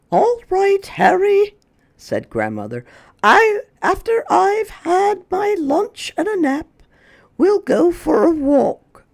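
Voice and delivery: high, gentle grandmotherly voice; a kindly granny promising a walk; Grandma. Acted. Grandma to Harry, high and kindly.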